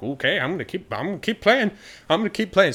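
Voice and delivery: carried away by the sight of gold; thrilled boy. Acted. The boy sees the banshees' gold and gets giddy, high and loud.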